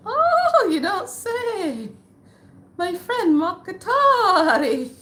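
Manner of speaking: very high, drawn-out hammed-up exclamation; exaggerated surprise. Reacting to the elder's answer, hammed-up delighted surprise. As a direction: crow the recognition in a slow, high voice.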